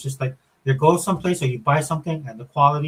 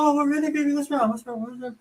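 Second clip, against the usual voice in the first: mock sweet concern; 12 semitones higher than usual. Dad teasing daughter in sweet voice.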